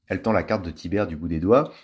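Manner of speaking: ordinary delivery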